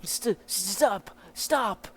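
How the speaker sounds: high, stammered plea to stop; stammer, then cry out; desperate protest. Acted. Captive to his interrogator, desperate protest.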